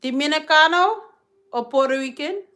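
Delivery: quick cabbie guess at her roots; imitating the driver's eager guess; cabbie's question. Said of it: "then they'd be like".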